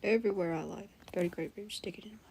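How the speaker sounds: very soft, half-whispered retry; hushed impression attempt. Shown as a demonstration. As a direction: practise the voice almost under your breath. Softly retries the impression.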